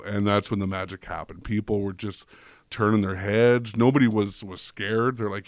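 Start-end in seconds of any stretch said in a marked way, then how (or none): none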